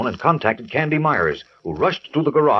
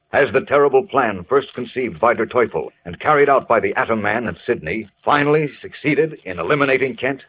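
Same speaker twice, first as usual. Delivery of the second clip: normal talking voice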